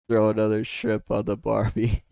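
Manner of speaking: half-joking Australian accent on Barbie; mock Australian accent. Shown as a demonstration. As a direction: give it a cheeky Aussie twang. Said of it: "I'm not gonna insult your accent".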